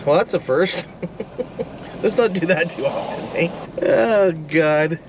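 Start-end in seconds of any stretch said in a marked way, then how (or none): 0.9-2.0 short breathy laugh. Real, not acted. After the drone's rough landing.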